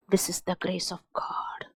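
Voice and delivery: breathy hushed whisper; whispered aside. Real, not acted. Preacher whispering God's grace to the congregation. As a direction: drop to a whisper for reverence.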